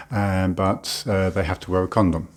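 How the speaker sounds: usual speaking voice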